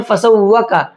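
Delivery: फसव्वाका hurried with the long vowel clipped; rushed, wrong. Shown as a demonstration. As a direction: say फसव्वाका too fast, the way people get it wrong. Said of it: "इसको जल्दी पढ़ देते हैं".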